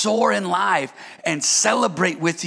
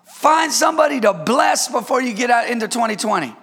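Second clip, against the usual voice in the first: ordinary talk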